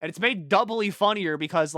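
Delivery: ordinary talk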